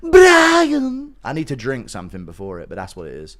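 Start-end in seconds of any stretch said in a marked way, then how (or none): 0.0-1.1 high, loud cartoon cry; shrieky cartoon character voice; Family Guy impression. Shown as a demonstration. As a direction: call out a long, high-pitched name. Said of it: "What's your best Family Guy impression?".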